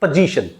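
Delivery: "position" with a weak schwa first, stress on the second syllable; correct pronunciation. Shown as a demonstration. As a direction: say "position" as puh-ZI-shun. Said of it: "It will be pronounced".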